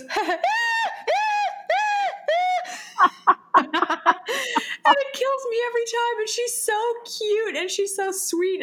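high squeaky laugh imitation; friend's laugh. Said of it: "she goes".

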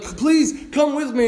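pleading. Retold or imitated. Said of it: "He's like".

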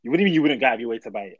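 usual speaking voice

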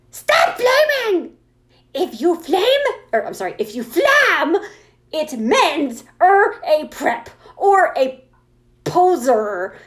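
slow, emphatic, indignant author's rant; character voice. Acted. Author scolding flamers in a note, slow indignant character voice. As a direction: shout the opening, then punch each misspelled word. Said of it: "read it in my character voice".

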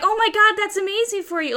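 high-pitched imitated congratulation; mock gushing. Wife congratulating him on the job, mock gushing.

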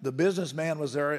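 ordinary talk, nothing marked

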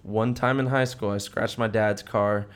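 ordinary talk

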